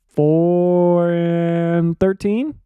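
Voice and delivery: very slow, stretched, rising into a question; long-held first word, questioning lift at the end; drawn-out guess. Real, not acted. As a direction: hesitate and drag the words out as a tentative guess. Guessing the team's season record.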